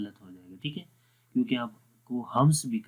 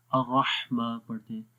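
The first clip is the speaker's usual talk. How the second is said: usual speaking voice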